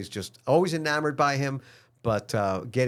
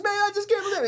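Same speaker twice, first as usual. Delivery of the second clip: much higher, tearful disbelief; weepy rock star, pitched way up; much faster than usual. Retold or imitated. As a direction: overcome and weepy. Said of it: "No, he's crying".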